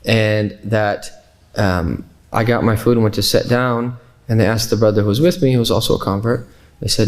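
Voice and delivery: ordinary talk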